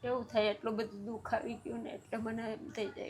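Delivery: quiet, tearful voice near a sob; crying. Real, not acted. Grandmother tells the interviewer of her grief, weeping quietly. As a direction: speak softly through real tears. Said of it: "કેમ રડો છો દાદી?".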